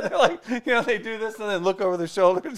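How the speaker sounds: high, loud, laughing through words. Real, not acted. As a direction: crack up while describing. Laughing describing gym dudes.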